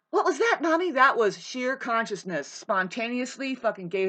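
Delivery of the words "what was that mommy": put-on sharp gasp, then a high, amazed little kid; a small child startled and asking mom; child's gasp. Kid gasping to mommy at the sudden sight, high and amazed. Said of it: "kids are gonna be going".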